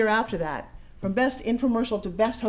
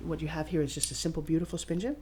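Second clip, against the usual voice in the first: ordinary delivery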